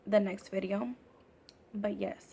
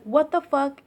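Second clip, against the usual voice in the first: higher, louder, exasperated snap; angry outburst; 5 semitones higher, 8 dB louder than usual. Retold or imitated. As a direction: blurt it like an angry older sister. Sister still pissed, uncovering her.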